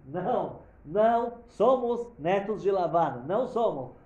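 high, loud, slow emphatic no; preached shout. Real, not acted. Preacher shouting to the congregation that they are not Laban's heirs. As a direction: hammer the denial home slowly.

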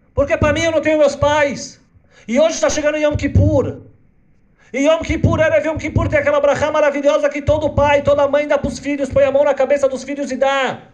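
put-on a small orphan girl telling her grief; little girl voice. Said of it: "Essa menina vai pro Rebbe e fala". (voz de menina órfã, aguda e alta)

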